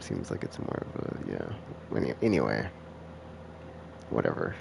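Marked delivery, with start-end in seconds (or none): none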